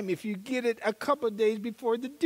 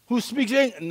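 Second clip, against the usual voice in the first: higher, louder calling out; pleading call into the phone; desperate call; 6 semitones higher, 6 dB louder than usual. Retold or imitated. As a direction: call down the line hoping someone answers. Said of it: "and I said".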